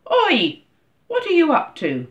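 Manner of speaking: loud, high angry shout of challenge. A narrator's reading. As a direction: call out crossly at someone meddling with your property. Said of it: "his face full of anger".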